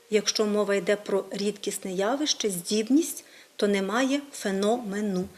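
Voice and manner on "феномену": феномену said феНОмену, beat on second syllable; stress on НО. Shown as a demonstration. Teacher showing the form for an event, stress on феНОмену. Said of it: "наголос все одно зберігається на складі".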